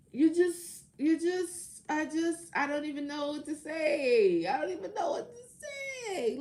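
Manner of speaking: high, tearful-sounding put-on wail; mock overwhelmed. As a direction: play being overcome and lost for words. Acts overcome, lost for words.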